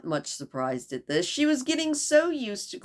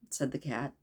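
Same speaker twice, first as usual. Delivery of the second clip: ordinary delivery, nothing marked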